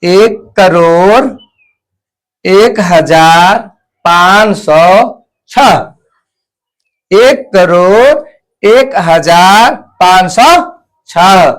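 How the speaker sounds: very slow, word by word, as if dictating; slow dictation. Shown as a demonstration. Teacher dictates the number to students, very slowly. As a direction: spell it out slowly for the class to write. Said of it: "तो ये लिखा गया कितना?".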